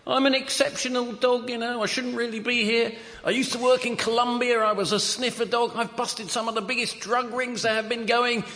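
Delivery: a lightly voiced cocky dog; boastful talking dog. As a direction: brag like a dog selling himself. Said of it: "the dog says".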